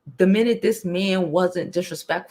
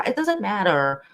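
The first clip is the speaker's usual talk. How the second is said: ordinary talk, nothing marked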